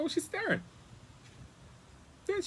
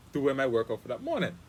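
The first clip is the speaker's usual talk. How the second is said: ordinary talk, nothing marked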